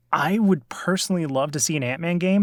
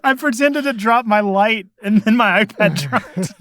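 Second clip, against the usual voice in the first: laughing while talking; 8 semitones higher, 6 dB louder than usual. Real, not acted. Laughing after dropping phone.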